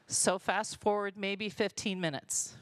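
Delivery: ordinary talk, nothing marked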